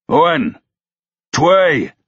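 high, loud calling count; yelled count. A narrator's reading. Yelled count for another heave.

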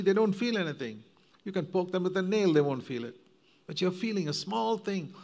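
высокая, напористая проповедь, с жаром; fervent preaching. Acted. Preaching at full force.

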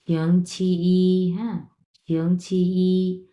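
শিয়ংচিই very slow, ending held long and level; flat first tone. Shown as a demonstration. Teacher models Monday, শিয়ংচিই, slow level-tone demonstration. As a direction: stretch the final "ee" of শিয়ংচিই on one note. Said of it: "সমতলভাবে একবারে ফ্ল্যাটভাবে উচ্চারণ করতে হবে".

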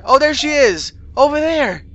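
high-pitched sighting call; excited calling out. Acted. Child spotting a missing sister, calling out excitedly.

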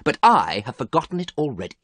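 understated irritation; angry. A narrator's reading. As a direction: insist it angrily. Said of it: "answered Dorian angrily".

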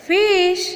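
ફિશ held out high; teacher's high, drawn-out ફિશ; English pronunciation demo. Shown as a demonstration. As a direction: model ફિશ for learners, pitched well up. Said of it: "અંગ્રેજી ઉચ્ચાર થશે".

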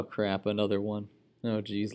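normal talking voice

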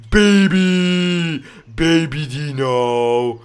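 high, loud, very slow drawn-out squeal; stretched delighted cry; excited squeal. Real, not acted. Seeing a baby dinosaur hatch.

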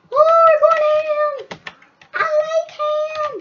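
high, loud joking cheer; hyped. Real, not acted. Gamer hyping himself before the hard level. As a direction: pump yourself up playfully.

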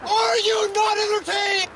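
high, slow, roaring arena cry again; gladiator shout. Acted. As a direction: bellow it to the crowd, arms wide. Repeats the gladiator quote.

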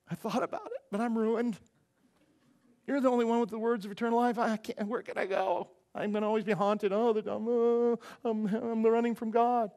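hammed-up, haunted moaning; mock despairing lament. Disciple with nowhere to go, mock anguished moaning. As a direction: wail it as someone hopelessly trapped. Peter acted as ruined, unable to flee.

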